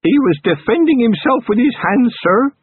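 high, slow and proud; grinning recruit getting it right; pleased young constable. A narrator's reading. Young constable proudly answering his commander, high and slow. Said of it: "and then he grinned".

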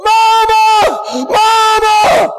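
put-on high, loud panicked cry of a lost child; yelling for mama. Lost boy calling his mother in the store, high panicked yell. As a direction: scream for your mother in the store. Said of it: "he yelled out very loudly".

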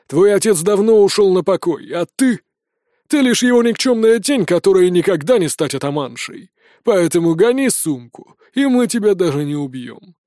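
gruff, forceful put-down; rough brigand laying down terms; scornful bandit chief. Acted. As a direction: belittle her, then threaten, slowing down.

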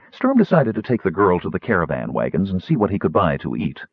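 ordinary delivery, nothing marked

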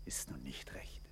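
a very soft whispered aside; hardly voiced, meant for no one. Paulet's muttered aside.

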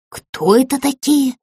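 ordinary delivery, nothing marked

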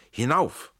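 high, loud urging cry; shouted command. A narrator's reading. Schell urges Tränk onto the wall, shouting. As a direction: shout your comrade up the wall. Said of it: "schreit Schell".

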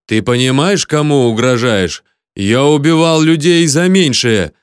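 slow threat rising to a loud finish; high, slow, angry warning; furious growl. A narrator's reading. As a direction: menace him, building in force. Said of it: "прорычал Филипп".

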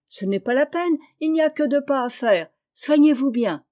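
voix de jeune fille un peu plus haute; réplique vive de Jo, perchée plus haut. A narrator's reading. Jo déclinant l'offre de Laurie. (voix de jeune fille, légèrement aiguë)

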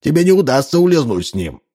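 high-pitched irate chief; narrated yell from an angry chief; angry shouting. A narrator's reading. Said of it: "кричал он".

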